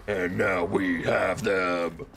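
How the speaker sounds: slow dramatic shout; slow shouted take. Shown as a demonstration. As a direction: draw it out and shout it.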